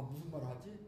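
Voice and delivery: normal talking voice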